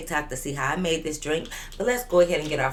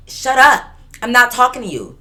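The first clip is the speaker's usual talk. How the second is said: loud, higher, exasperated snap; acted angry retort; 6 semitones higher, 10 dB louder than usual. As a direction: shut her down loudly mid-quarrel. Acted quarrel with Veronica.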